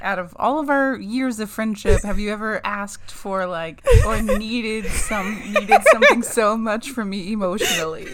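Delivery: loud, laughing mock heartfelt speech; laugh-crying the words out; laughing through words. Overwhelmed mock emotional speech.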